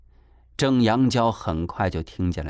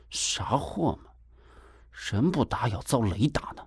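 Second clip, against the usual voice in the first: huffy muttering; 6 dB quieter than usual. A narrator's reading. Young man grumbles after father's apology, huffy muttering. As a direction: mutter it crossly under your breath. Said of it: "又气呼呼地嘟哝了一句".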